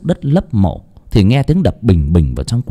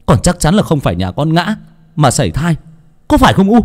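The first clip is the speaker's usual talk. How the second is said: higher, shouting accusation; angry shout; 10 semitones higher than usual. A narrator's reading. Son shouts at his mother for her cruelty. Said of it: "mà quát".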